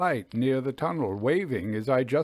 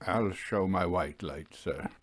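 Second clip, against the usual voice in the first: peculiar low voice; 7 semitones lower, 6 dB quieter than usual. A narrator's reading. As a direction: lower and softer, strangely hushed. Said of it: "he said in his peculiar low voice".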